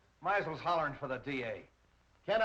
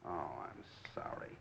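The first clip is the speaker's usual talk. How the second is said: breathy whispered apology, voice dropped away; 13 semitones lower, 12 dB quieter than usual. Acted. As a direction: sigh it out under the breath. Tired detective apologizing to girlfriend.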